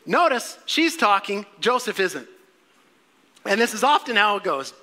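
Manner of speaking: ordinary delivery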